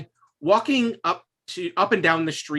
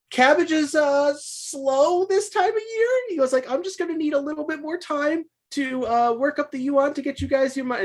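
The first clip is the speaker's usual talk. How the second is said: nervous cabbage man; 11 semitones higher than usual. Acted. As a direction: stall for time in a high, anxious voice. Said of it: "he just goes like".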